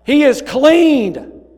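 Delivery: high, loud, slow proclamation; priest crying out. Retold or imitated. Priest announcing the leper's cleansing, ringing loud slow cry. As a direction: ring it out like a priest announcing a healing. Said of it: "cry out".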